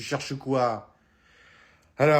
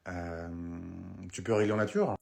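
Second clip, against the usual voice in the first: suggestive offer; 9 semitones lower, 6 dB quieter than usual. Acted. Broke customer slyly proposing to pay the waiter in kind, low and soft. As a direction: lower and softer, a sly insinuating proposal.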